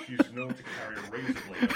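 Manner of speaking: laughing through the words; cracking up mid-sentence. Real, not acted. Father joking about his daughter while laughing.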